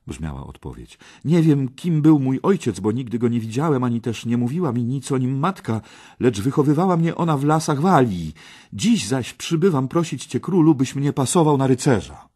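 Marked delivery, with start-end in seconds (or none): 1.2-12.3 put-on high, loud, eager young petitioner; earnest young Parsival. Parsival asks the king for knighthood, high and eager. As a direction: plead your case boldly and quickly.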